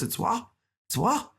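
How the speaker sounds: high rising disbelief; incredulous. Retold or imitated. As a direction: Behan shocked he's being recorded, high incredulous. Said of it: "He goes".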